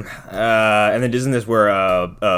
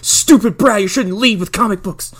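mock scold; 10 semitones higher, 5 dB louder than usual.

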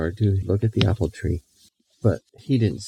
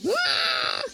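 mock high scream; 4 dB quieter than usual. Girl screams running.

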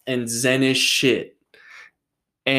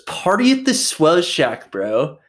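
higher, louder surfer-dude imitation; Dylan's surfer bro voice; 5 semitones higher, 4 dB louder than usual. Quoting how his friend now talks, mock surfer bro. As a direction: ham up a chill beach bro. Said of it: "now he talks like that".

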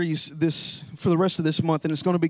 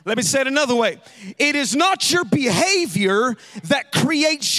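raised, ringing pulpit voice; preaching at full force; 4 semitones higher than usual. Acted. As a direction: drive the point home to the congregation. Preaching climax.

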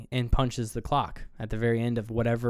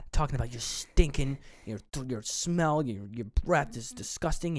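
mild mock rant. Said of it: "he literally loses it".

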